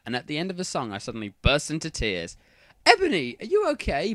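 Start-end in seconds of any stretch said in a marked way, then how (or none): 2.8-4.1 high-pitched worried question; concerned. A narrator's reading. Bloody Mary to crying Ebony, concerned. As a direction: check on her with alarm. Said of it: "asked in a concerted voice".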